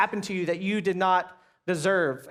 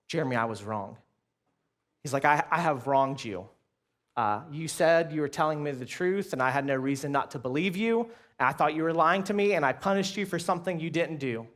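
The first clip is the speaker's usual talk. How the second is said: a hint of a humbled father, lower and softer; quiet remorseful dad; 5 semitones lower, 4 dB quieter than usual. Dad apologizing to his son, lower and softer, remorseful. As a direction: voice a big man admitting his wrong, low and quiet.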